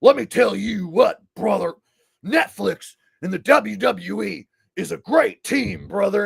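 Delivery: high wrestler promo voice, pushing on; Hulk Hogan. Retold or imitated. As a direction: hype it up like a wrestler winning back the crowd. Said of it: "tried to regain himself and be like".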